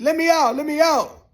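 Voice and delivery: high, loud pleading yell; begging to be let out. Retold or imitated. Prisoner begging the officer, high loud plea. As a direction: plead at the top of your voice.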